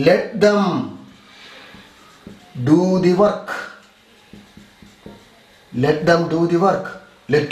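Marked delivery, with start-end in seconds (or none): 0.1-3.5 phrase by phrase with a long pause; slow dictation. Shown as a demonstration. Teacher giving students an example to write, slow dictation. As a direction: leave time to write between the halves.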